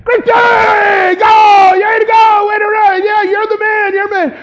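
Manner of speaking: high, loud cheering shout. Retold or imitated. Jerry shouts from behind the scoreboard at the walker, cheering.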